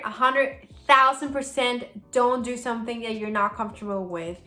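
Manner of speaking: ordinary talk, nothing marked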